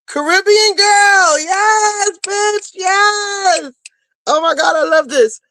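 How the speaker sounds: cheering a new Patreon supporter; joyful shout. Real, not acted. New member alert.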